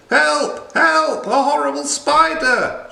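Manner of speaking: high, loud panicked shriek; terrified scream. Acted. Miss Muffet screaming at a spider in the cottage, high and loud. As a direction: shriek for help as if the spider is right there. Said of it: "she screamed".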